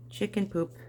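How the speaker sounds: normal talking voice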